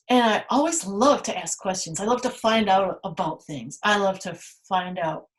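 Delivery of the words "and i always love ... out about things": normal talking voice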